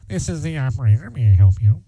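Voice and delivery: pinched nasal telephone operator; nasally operator voice. Retold or imitated. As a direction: play a nasal old switchboard operator. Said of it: "some lady with a nasally voice says".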